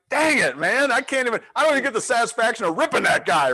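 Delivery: mock frustration. Driver denied chance to rip barista, high loud mock whine. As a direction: whine like you were robbed of the fun, quick. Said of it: "I'm like".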